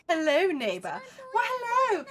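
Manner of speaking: excited greeting. Real, not acted.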